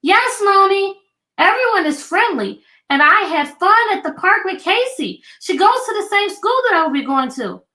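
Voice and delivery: put-on high, lively child voice; a daughter telling mom about her day; little girl's voice. A narrator's reading. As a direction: read it as a happy little girl. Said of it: "answered Amira".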